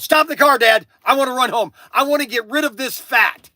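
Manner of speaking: loud, high insistent demand; son yelling. Retold or imitated. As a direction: yell it like a teen who means it. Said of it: "He just yelled".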